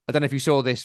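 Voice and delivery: usual speaking voice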